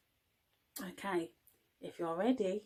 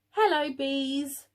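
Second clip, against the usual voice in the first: queen bee greeting; 5 semitones higher, 5 dB louder, slower than usual. Acted.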